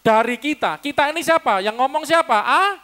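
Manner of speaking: high, loud preacher demanding an answer; loud quizzing. Real, not acted. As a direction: fire questions loudly at the congregation. Quizzing congregation after their reading.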